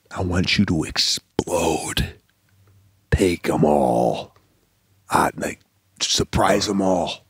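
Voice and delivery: low, slow, confiding instruction; imitating a director's hushed aside. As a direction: draw the words out like a secret plan. Director's private instruction, retold.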